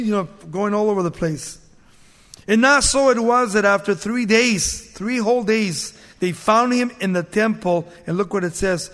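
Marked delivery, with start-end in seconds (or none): 2.5-7.9 raised, ringing Bible reading; preacher reading scripture, a little higher and louder. Acted. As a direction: read the passage out to the congregation. Reads scripture aloud.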